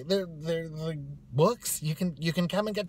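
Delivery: high, flustered false starts; floundering. Retold or imitated. Librarian at a loss before new hires, stammering nervously. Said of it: "she doesn't know what to say".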